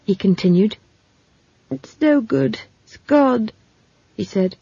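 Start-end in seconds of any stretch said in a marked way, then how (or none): 1.7-3.5 giving up. Acted. Simon gives up trying.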